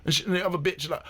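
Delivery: normal talking voice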